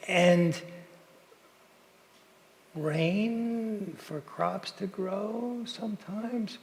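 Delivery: drawn-out, deliberate, very slow teacherly voice; slow explaining man. Retold or imitated. Man patiently explaining weather to an American, very slow. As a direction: spell it out slowly like explaining to a child.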